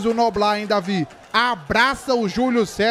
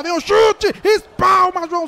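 excited play-by-play; 6 semitones higher, 5 dB louder than usual. Real, not acted. Shot in live play-by-play.

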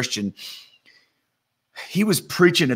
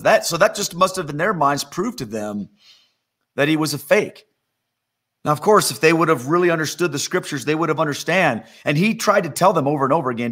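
ordinary talk

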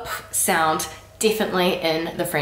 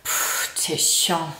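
soft, fed-up pff then t'es chiant; annoyed; 3 semitones lower, 4 dB quieter, much slower than usual. Shown as a demonstration. Telling a friend they're annoying, fed up. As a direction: dismiss them with a puff. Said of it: "someone was quite annoying".

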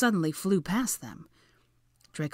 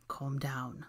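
low-key, hushed reassurance; soft; 3 semitones lower, 8 dB quieter than usual. A narrator's reading. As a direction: say it softly to soothe. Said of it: "Draco said softly".

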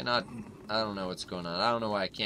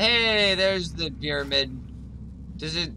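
much higher, louder hey; excited discovery; 6 semitones higher, 4 dB louder than usual. Real, not acted. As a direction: point it out, thrilled. Spotting pyramid in game.

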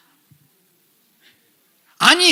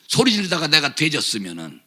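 usual speaking voice

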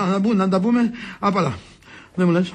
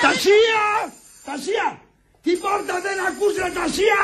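high, urgent summons; impatient calling out; 8 semitones higher than usual. Acted. Master calling the maid to answer door, impatient yell. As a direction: call through the house, irritated.

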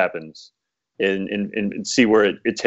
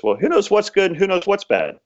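wise farmer; 10 semitones higher than usual. Retold or imitated. Calm farmer answers grieving neighbor.

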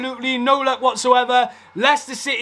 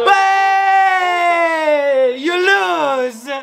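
gloating taunt; 3 semitones higher, much slower than usual. Real, not acted. Fan taunting the rival manager at full time, a gleeful jeer. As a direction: rub it in at the whistle.

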